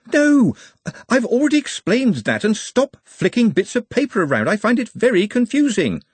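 very high, flustered outburst; exasperated. A narrator's reading. Ponder telling men to stop flicking paper, exasperated. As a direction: start with a loud high NO, stay shrilly flustered.

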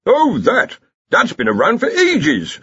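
high, scoffing academic; dismissive Dean. A narrator's reading. Dean in story.

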